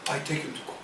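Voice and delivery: hushed quoted retort; dropped to a hush. Retold or imitated. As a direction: let the line fall away to a whisper. Quoting challenge, dropped voice.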